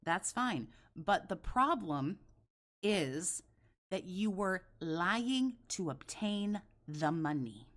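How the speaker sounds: ordinary delivery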